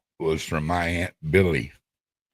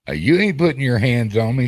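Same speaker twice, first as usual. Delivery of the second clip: louder, higher defiant back talk; defiant retort; 6 semitones higher, 6 dB louder than usual. Retold or imitated. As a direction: stand up to the aunt, daring her. Defying his angry aunt.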